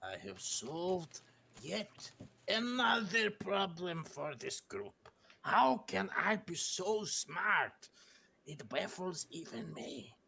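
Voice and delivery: slow, self-impressed character voice; Boris. Acted. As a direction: savour each word in slow, grand self-admiration. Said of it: "In his mind, Boris is like".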